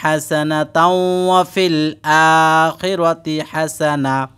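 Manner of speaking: slow chant with every long vowel stretched; drawn-out Quranic recitation. Shown as a demonstration. Teacher shows students how to recite the dua, slow drawn-out chant. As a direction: intone it slowly, holding each madd. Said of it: "এই দোয়াটা পাঠ করবেন".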